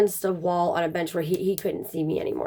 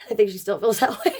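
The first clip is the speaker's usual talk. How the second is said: laughing through the words; amused, words breaking up into real laughter; faster than usual. Real, not acted. Woman joking about her mother-in-law's disapproval, laughing.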